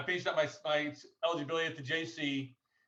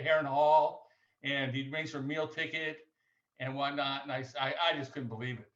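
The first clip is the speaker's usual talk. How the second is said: ordinary delivery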